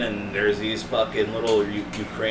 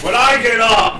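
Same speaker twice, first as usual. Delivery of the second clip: high, loud parental threat; loud dad threat; 11 semitones higher, 10 dB louder than usual. Retold or imitated. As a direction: bark it like an angry dad. Imitating dad's threat.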